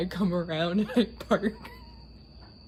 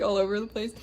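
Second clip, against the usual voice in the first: normal talking voice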